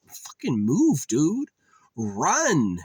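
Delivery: high, loud, drawn-out yell; mock-frantic yell at a slow character; exasperated urging. Player urging the stunned game hero, exasperated yell.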